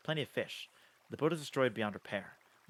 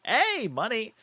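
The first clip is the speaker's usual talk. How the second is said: excited find; 11 semitones higher, 9 dB louder, much slower than usual. Real, not acted. Found a dragon bone.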